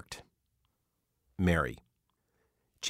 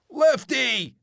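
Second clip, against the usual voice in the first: much higher, louder shout of the name; desperate yell; 7 dB louder than usual. Acted. Gary yells desperately after Lefty speeding past. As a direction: scream it after the car. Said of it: "Gary's desperate".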